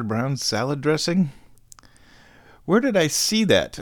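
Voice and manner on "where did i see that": puzzled. Real, not acted. Sudden puzzled question.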